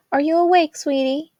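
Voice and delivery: high, loud mom calling through the house; a mother calling up to her daughter; Maddie, calling gently. A narrator's reading. Maddie calls to Jazz from the stairs, high and loud.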